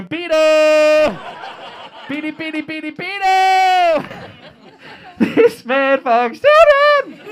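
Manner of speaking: loud, high, stretched-out siren wail; hammed-up blaring accusation; inner alarm. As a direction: sound the alarm with long held shouts. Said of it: "Your head, you go".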